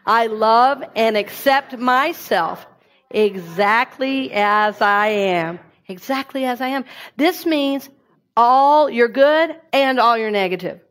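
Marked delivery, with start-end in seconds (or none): 0.0-5.6 slow, deliberate affirmation; unhurried shared mantra. Shown as a demonstration. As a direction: say each word slowly with the congregation. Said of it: "Will you say that with me?".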